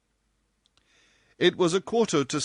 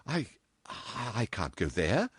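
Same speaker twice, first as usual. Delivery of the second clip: stammering; 3 semitones higher than usual. A narrator's reading. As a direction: falter and repeat before getting it out. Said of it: "she stammered".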